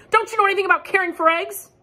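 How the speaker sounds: high, loud and fast, exasperated; scolding. Acted. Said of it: "Duck scolded".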